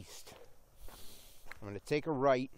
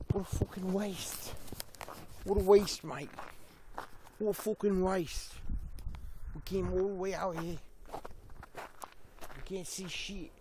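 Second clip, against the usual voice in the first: higher hammed-up Australian accent; mock Australian accent; 4 semitones higher than usual. Vlogger jokes to himself about closed dogs, mock Australian accent. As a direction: play a frustrated Aussie bloke, hammed up. Giddy put-on voice saying mate.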